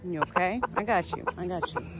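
soft, low reassurance; soothing. Real, not acted. Owner to hurt bird, soft soothing. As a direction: comfort a frightened animal softly.

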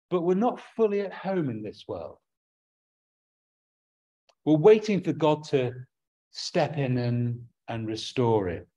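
normal talking voice

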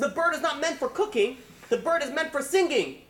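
high-pitched, acted scolding; exasperated rebuke. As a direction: hammer home the correction as an indignant master. Duke rebukes servant in story.

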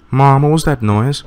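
a hint of a frightened daughter; scared child. Daughter asking mother about the noise, girlish. Child voiced in game scene.

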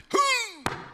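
very high, falling "hoo"; high hoot. Acted. Comic on going up north, high hoot. Exclamation about Geordie land.

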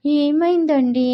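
high, slow, wide-eyed innocent question; a naive young wife's slow, high innocent ask; innocently. A narrator's reading. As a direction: ask sweetly, playing innocent. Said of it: "అంది అమాయకంగా".

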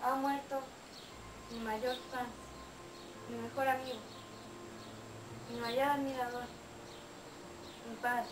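understated sorrow, low and halting; grieving. Acted. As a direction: break the news haltingly, phrase by phrase. Narrator reports her father's death.

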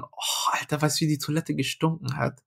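breathy, hushed disgust; hushed aside. Real, not acted. Telling the friend how the toilet stank, hushed. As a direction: lean in and hush it.

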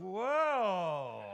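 long, swooping whoa that slides down; wowed by the wife's new dress; drawn-out appreciative exclamation; admiring whoa. Acted. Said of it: "she's got a new dress on".

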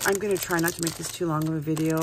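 ordinary talk, nothing marked

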